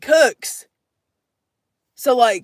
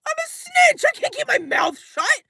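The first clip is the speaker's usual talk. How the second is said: mock panic; 10 semitones higher, slower than usual. Real, not acted. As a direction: wail it like you're doomed. Panicked reaction to stolen car.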